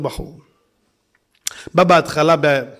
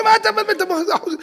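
higher, fast dismissive salesman; scoffing salesman; much faster than usual. Retold or imitated. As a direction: brush off the question. (מוכר מזלזל במהירות)

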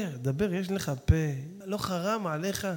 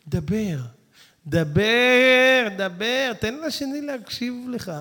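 insistent urging; 3 semitones higher, 7 dB louder, much slower than usual. Acted. Urging to speak.

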